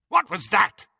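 loud alarmed shout. Acted. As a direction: bark it from upstairs. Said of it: "shouted Lucius Malfoy".

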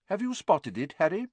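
a gentle teacher quizzing his pupil; Lupin, mild teacher. A narrator's reading. Lupin asks the class.

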